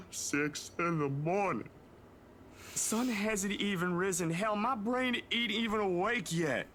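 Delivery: high, whiny grumble; whiny complaining. Acted. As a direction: complain like a grumpy sleeper. Groggy friend complaining, voiced high.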